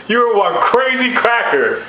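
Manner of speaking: high, drawn-out amused exclamation; tickled, slow and high; amused exclaiming. Acted. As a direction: stretch it out high between laughs. God amused, between laughs.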